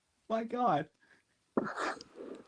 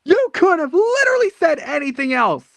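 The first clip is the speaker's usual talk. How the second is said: exasperated; 6 dB louder than usual. Real, not acted. Exasperated at friend's excuse.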